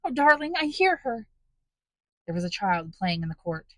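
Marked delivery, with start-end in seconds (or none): 0.0-1.3 high, eager, breathless joy; joyful cry. A narrator's reading. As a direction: she hears her child, voice leaps up. Fantine hearing her child.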